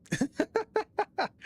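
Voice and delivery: sudden high-pitched laugh; high burst of laughter. Real, not acted. Laughs at pirate voice request.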